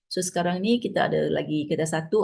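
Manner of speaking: usual speaking voice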